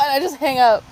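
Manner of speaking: normal talking voice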